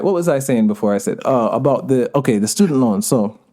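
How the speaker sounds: ordinary talk, nothing marked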